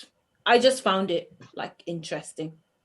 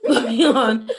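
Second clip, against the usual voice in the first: laughing; 2 semitones higher, 7 dB louder than usual. Real, not acted. Laughing through moving on.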